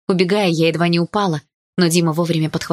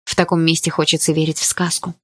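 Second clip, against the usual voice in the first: plain reading voice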